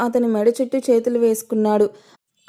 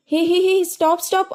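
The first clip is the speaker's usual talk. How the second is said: mild mock shout, pitched much higher; 7 semitones higher than usual. A narrator's reading. Tarak panicking with the squirming baby, mild mock shout. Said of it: "అంటూ గట్టిగా అరిచాడు".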